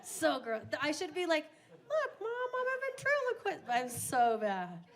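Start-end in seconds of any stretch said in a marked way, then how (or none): 1.9-3.6 much higher, squeaky puppet voice; silly ventriloquist voice. Pretend. Taunting mom with a dentures gag, squeaky high. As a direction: pipe it up like a dummy.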